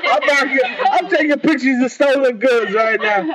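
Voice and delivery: excited. Real, not acted. Teasing friend about stolen costume.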